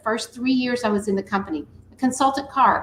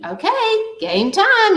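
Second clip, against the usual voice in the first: excited announcement; 9 semitones higher, 5 dB louder, slower than usual. Acted. Host announces next game round.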